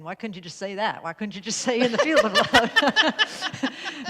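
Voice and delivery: slow, loud Southern drawl with a laugh; Southern accent. Retold or imitated. Host mimicking Linda's objection in a Southern accent. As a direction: tease her in a Southern accent. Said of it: "Notice I immediately donned a Southern accent when I started imitating you".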